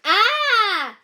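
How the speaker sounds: high, loud pained yelp; gorilla yell. Acted. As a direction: yelp as if bitten. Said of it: "the gorilla yelled".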